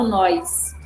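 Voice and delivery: "nós" said as "nóis", with a glide; dialect pronunciation. Shown as a demonstration. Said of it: "eu falo nós".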